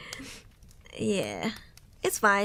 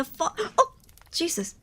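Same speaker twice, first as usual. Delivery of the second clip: startled gasp; 4 semitones higher than usual. Real, not acted. Blackout startles streamer mid-story.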